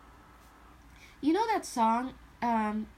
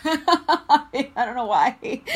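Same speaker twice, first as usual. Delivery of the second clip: laughing; 4 semitones higher, 10 dB louder, much slower than usual. Real, not acted. Laughs at her own aside.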